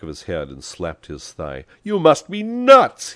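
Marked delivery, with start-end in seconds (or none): 1.8-3.1 loud, high, drawn-out bellow. A narrator's reading. Pepone to his unafraid passenger, bellowing. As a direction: bellow it in exasperated disbelief. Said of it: "he bellowed".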